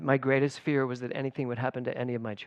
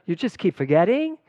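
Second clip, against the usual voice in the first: put-on Valley-girl uptalk, louder and higher, rising on "forgetting"; 4 semitones higher, 5 dB louder than usual. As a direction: lift the end like a Valley girl. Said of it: "turning into a Valley girl".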